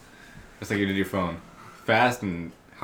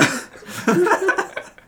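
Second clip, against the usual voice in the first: squealing burst of laughter; high-pitched laugh; 4 dB louder than usual. Real, not acted. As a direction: crack up in a high giggle. Cracking up at friend's boast.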